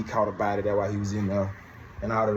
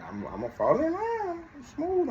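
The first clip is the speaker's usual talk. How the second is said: much higher, put-on smooth-guy voice; mock smooth voice. Mocking himself to the boss, high put-on smooth voice. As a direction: play it cool in a silly high voice. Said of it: "I'm like".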